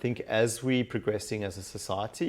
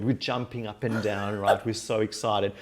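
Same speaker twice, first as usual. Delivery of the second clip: ordinary delivery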